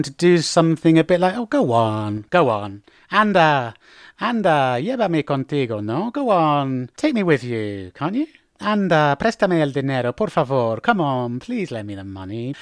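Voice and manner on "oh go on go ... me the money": persuading, pleading. Shown as a demonstration. Asking to come along or borrow money, coaxing. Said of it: "trying to convince or persuade someone".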